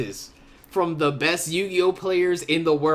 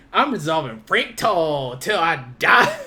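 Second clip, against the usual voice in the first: mock battle cry, louder; defiant shout; 5 dB louder than usual. Host imitating Austin swearing loyalty to his deck, mock shout. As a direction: yell it like a vow. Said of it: "it's like".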